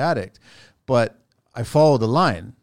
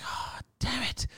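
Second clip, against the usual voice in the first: breathy whispered oh damn it, higher pitch; whispered curse; 10 semitones higher, 9 dB quieter, slower than usual. Retold or imitated. Crew guy sees the bag burst, whispers a panicked curse. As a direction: hiss the curse under your breath in dismay.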